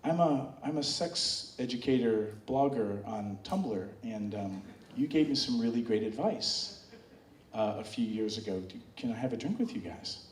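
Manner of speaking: soft, low, bashful request; shy quiet fan. Retold or imitated. Fan shyly asks to join, soft and low. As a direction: ask timidly, barely above a murmur. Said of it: "And he's like".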